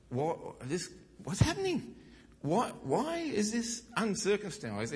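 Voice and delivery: put-on high, baffled young voice, drawn out; a boy who can't believe what he sees; naive teen David. Preacher voicing young David, baffled and high.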